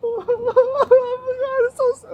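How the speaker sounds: very high, loud mock wail; attempted fake cry. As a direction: try to force a cry on a squealed voice. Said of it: "okay, can't cry".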